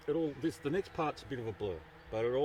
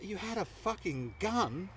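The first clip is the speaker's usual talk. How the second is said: high, slow incredulous imitation of a father; aghast disbelief; 8 semitones higher, slower than usual. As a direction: draw the question out, appalled.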